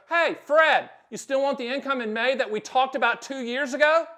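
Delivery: a hint of a chipper advisor calling a client; cheerful phone call. Advisor calling client Fred about May income, lightly voiced cheerful greeting.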